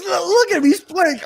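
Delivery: cousin. Retold or imitated. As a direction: insist the biting dog is just playing.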